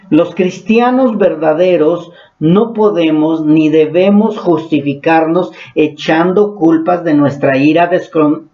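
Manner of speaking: ordinary talk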